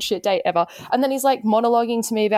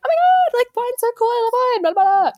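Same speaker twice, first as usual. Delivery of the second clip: very high, gushing enthusiasm; super bubbly; 14 semitones higher, 4 dB louder than usual. Retold or imitated. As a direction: overdo the friendly, accommodating date. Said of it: "being super friendly and accommodating and bubbly".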